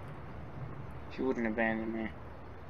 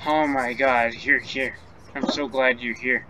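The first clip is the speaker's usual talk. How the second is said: ordinary talk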